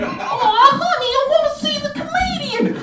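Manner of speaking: high squeaky wife voice. Retold or imitated. Wife's voice in story.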